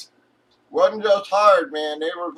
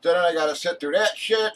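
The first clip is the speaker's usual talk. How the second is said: ordinary talk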